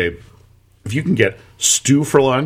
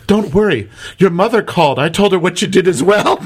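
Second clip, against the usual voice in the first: high, sweetly scolding Irish voice; mock-motherly Irish lecture; 8 semitones higher, 4 dB louder than usual. Bartender scolds the girl over the phone in an Irish accent. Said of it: "And she goes".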